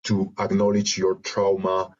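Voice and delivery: normal talking voice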